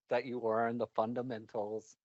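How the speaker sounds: ordinary talk, nothing marked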